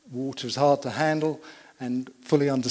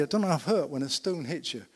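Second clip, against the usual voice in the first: ordinary delivery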